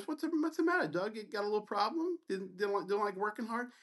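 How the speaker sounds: high-pitched taunting mock sympathy. Resident keeps needling a student before his group, mocking. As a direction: tease him like a little kid. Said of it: "continues to mock me".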